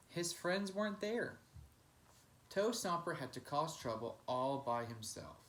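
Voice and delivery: plain reading voice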